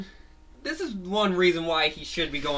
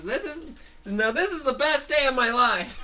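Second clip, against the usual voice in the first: high, beaming declaration; thrilled cartoon hero; overjoyed hero; 9 semitones higher than usual. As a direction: savor the best day ever. Fango's best day.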